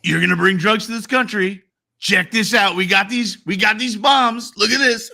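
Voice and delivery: mock boastful Trump. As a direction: show off the bombs like a proud kid.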